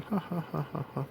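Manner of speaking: soft, mock hero chuckle; He-Man laugh. Reviewer mimics the toy's alternate laughing face, soft fake laugh. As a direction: imitate a cartoon laugh under your breath, evenly spaced. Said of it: "homage to that laughing He-Man".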